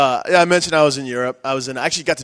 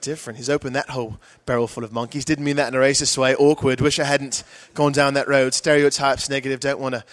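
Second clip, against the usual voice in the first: British audience member. Retold or imitated. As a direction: explain awkwardly in a put-on British accent. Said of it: "this is what the crowd would do".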